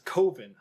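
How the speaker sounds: COH-vin for Coven; long-O Coven. Retold or imitated. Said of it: "not how I learned how to say".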